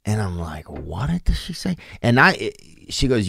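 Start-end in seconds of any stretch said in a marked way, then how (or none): 0.7-1.8 puzzled aside. Retold or imitated. Acts own puzzled reaction to lady.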